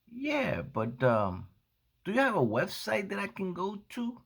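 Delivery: lightly voiced high young woman asking; young customer voice.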